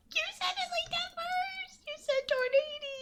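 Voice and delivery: very high, squeaky mock indignation; tiny high-pitched whine; squeaky protest. Squeaky protest over teased word.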